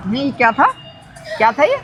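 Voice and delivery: baffled, not believing it; stunned disbelief. Real, not acted. Stunned by prank reveal. (हैरानी से, ऊँची आवाज़ में)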